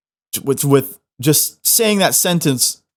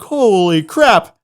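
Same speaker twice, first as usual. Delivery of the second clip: higher, drawn-out mock amazement; amazed; 5 semitones higher, much slower than usual. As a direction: stretch it out slowly, awestruck. Retelling his amazed reaction.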